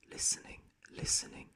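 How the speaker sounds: soft unvoiced whisper. A narrator's reading. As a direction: breathe it silently like a sneaking thief. Creeping thief listening.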